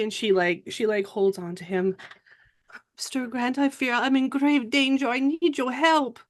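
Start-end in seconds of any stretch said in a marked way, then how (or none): 3.0-6.2 high-pitched French damsel in danger; pleading Frenchwoman. Acted. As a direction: plead urgently without shouting, French-accented. French character pleads for help.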